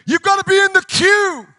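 high, loud mock pulpit shout; re-enacted preaching call. Re-enacts his own revival preaching.

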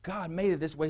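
normal talking voice

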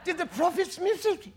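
exaggerated high British accent. Preacher repeats the doubters' question for laughs, mock British accent. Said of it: "I'm doing my greatest British accent".

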